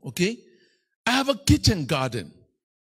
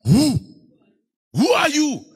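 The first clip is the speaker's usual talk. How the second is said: big dog asking; 4 semitones higher, 7 dB louder, slower than usual. Acted. Preacher voicing his big Boer dog confronting thieves, loud challenge. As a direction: boom out the questions like a guard dog standing its ground. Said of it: "It stands and it asks".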